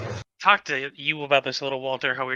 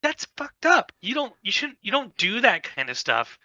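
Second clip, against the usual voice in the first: outraged; 7 semitones higher than usual. Retold or imitated. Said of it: "I'm like".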